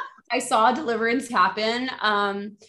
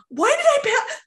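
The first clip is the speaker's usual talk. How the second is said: high bewildered squeak; 5 dB louder than usual. Retold or imitated. Retold confusion after fainting.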